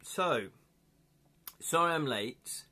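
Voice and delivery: talking through hiccups; hiccupping between words. Real, not acted. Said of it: "oh, I've got the hiccups now".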